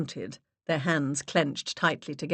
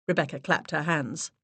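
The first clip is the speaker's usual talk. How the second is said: ordinary delivery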